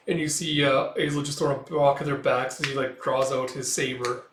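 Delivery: plain reading voice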